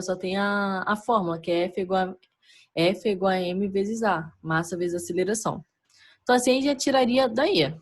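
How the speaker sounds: usual speaking voice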